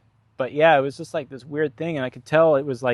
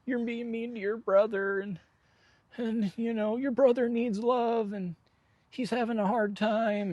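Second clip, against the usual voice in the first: mock weepy, pitched way up; imitating crying dad; 9 semitones higher, 4 dB quieter than usual. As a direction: mimic a dad pleading through tears. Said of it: "he's, like, starting to cry".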